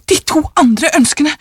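high, eager answer; quick reply. A narrator's reading. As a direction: answer at once, excitedly. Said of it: "svarte hun fort".

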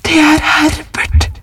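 high, drawn-out frantic cry; shriek. A narrator's reading. Wife believes her son is knocking, high shriek. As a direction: shriek the name in a high pitch. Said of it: "skrek hun".

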